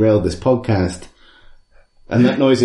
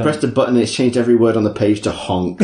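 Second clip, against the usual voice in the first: fast exasperated rush; much faster than usual. Real, not acted. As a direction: blurt it out fast. Frantic after prank.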